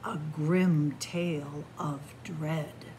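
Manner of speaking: plain reading voice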